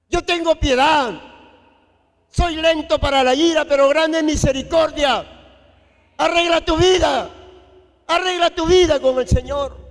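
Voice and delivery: loud, high, fervent preaching cry; full-force preaching. Acted. Pastor urging the congregation back to Christ, loud fervent preaching. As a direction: preach it out to the whole church at full voice.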